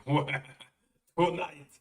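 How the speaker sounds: loud gruff mock Carl; Carl impression. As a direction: bark it like a lazy slob. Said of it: "He goes".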